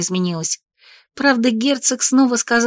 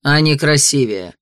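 low, slow, cutting; cold; 6 semitones lower than usual. Acted. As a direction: coldly add the sting. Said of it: "холодно произнёс лорд".

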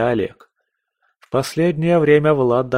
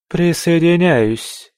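higher, slow woman's voice; female voice; 7 semitones higher, 4 dB louder, slower than usual. Acted. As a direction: agree slowly in a higher voice. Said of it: "женский голос".

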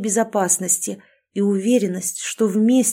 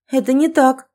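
higher and louder, indignant; indignant protest; 6 semitones higher, 6 dB louder than usual. A narrator's reading. Wife protests indignantly to her husband. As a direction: push back hard, outraged. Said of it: "возмутилась".

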